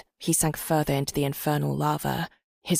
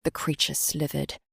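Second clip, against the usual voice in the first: plain reading voice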